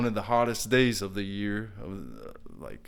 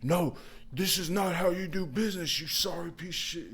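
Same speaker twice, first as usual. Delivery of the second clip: imitating yelling customer; 8 semitones higher than usual. Customer cutting off contractor's offer to come back, high mock-angry yell. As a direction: cut in like an irate client. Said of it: "please stop yelling".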